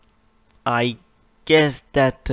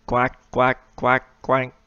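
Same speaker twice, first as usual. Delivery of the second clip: duck quacking; slower than usual. Pretend. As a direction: quack like a duck on a viewer's request. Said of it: "Can I crack twenty times?".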